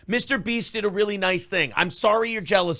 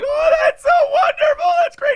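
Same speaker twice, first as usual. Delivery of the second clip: much higher, louder shrill gush; mock gushing voice; 7 dB louder than usual. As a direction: squeal it like a gushing fangirl. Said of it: "she's like".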